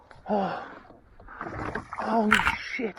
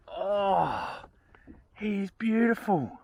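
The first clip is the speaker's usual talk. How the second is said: ordinary talk, nothing marked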